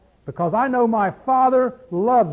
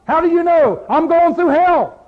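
proud shout; 8 semitones higher, 7 dB louder than usual. Pretend. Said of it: "You've got a resume written here".

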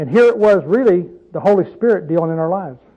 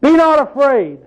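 raised, higher, commanding; bold declaration; 7 semitones higher than usual. Retold or imitated. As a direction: proclaim it boldly to the woman. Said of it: "She said".

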